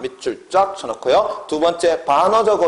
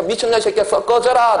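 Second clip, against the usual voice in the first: high-pitched mock harsh command; 명령적 어조; 7 semitones higher than usual. Shown as a demonstration. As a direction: order someone away in a demonstration of imperative tone. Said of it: "명령적 어조". (높은 톤의 명령조 시범)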